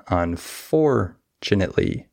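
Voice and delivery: "unfortunately" broken out with a big FOR; stress on FOR. Shown as a demonstration. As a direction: stretch and punch FOR in "unfortunately", "tunately" light. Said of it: "I have stress on for".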